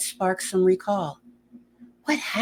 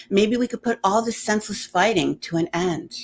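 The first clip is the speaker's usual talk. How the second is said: plain reading voice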